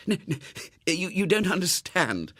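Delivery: put-on a boy begging to be understood; desperate. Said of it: "said Robert desperately".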